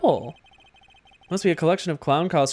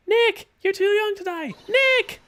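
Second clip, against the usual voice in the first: much higher, frantic calling out; panicked cry; slower than usual. Acted. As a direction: yell for your friend in a panic. Maya panics over Nick.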